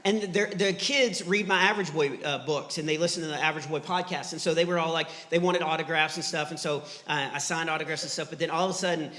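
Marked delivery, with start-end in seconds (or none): none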